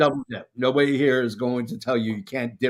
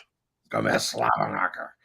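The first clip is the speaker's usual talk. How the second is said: much lower, hammed-up J R call; J R impression; 3 dB quieter than usual. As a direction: growl it like an old-school commentator. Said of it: "Good old J R".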